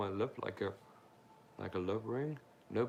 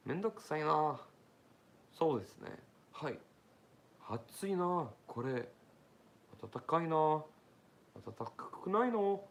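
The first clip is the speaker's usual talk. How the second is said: higher, louder stretched-out exclamations; mock Japanese small talk; 6 semitones higher, 5 dB louder than usual. Speaker showing off basic Japanese that fooled people, exaggerated exclaiming. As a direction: play up casual Japanese complaints. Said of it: "I'm like".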